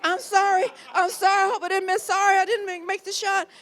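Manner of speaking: high, rushed apologetic actress; flustered young actress apologizing; flustered apology. Retold or imitated. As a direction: gush out the apology.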